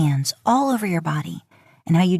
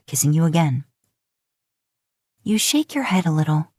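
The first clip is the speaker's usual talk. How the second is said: usual speaking voice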